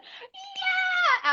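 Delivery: sudden very high, wild cheer; Gloria whoop. Pretend. Hosting as her character, a high excited whoop. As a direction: whoop it up like a hammy host. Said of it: "And I'd be like".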